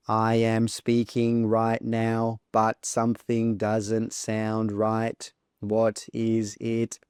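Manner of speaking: slow, monotone speech with no stress or rhythm; robotic monotone. Shown as a demonstration. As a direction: speak like a robot, every word equally weighted. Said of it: "my speech sounds a bit funny".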